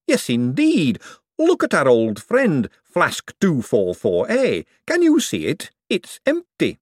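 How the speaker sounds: high, slow, awed excitement; excited. Acted. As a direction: marvel at the power. Hubert alarmed.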